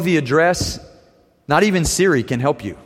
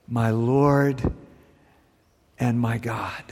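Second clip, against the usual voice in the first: much lower and softer, awed; reverent confession; 8 semitones lower, 7 dB quieter, slower than usual. Acted. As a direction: breathe it as a hushed confession. Thomas's climactic confession.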